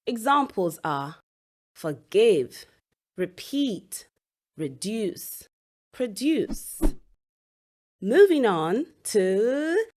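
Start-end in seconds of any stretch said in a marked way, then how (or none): 1.7-6.8 "forgive", "repeat", "reduce", "produce", each stressed on the second syllable. Shown as a demonstration. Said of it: "stress on the second syllable".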